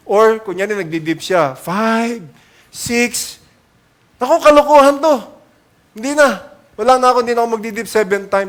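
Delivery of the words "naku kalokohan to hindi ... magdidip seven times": loud, fast, fed-up grumble; exasperated quitting. Pretend. Naaman grumbling he will quit the dipping, loud and fast. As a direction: throw up your hands and rattle it off.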